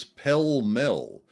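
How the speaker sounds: "Pell Mell" with both vowels as short e; brand-name pronunciation. Retold or imitated. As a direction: rhyme both words of "Pell Mell" with bell. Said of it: "he pronounced the cigarette brand as".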